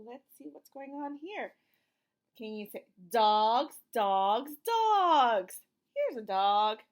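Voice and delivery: ordinary talk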